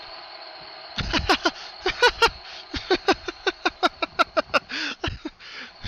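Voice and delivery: high laughter growing stronger; building laughter. Acted. Pinkie laughing off her fear, high building laughter. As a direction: laugh freely, getting bigger. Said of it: "She was laughing".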